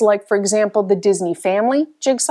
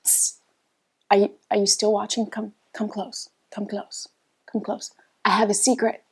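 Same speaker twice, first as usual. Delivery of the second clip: hushed and close, barely voiced; conspiratorial whisper; 7 dB quieter than usual. Real, not acted. Vlogger drawing viewers in after the sign-off, hushed whisper. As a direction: lean in and whisper like sharing a secret. Post-credits secret to viewers.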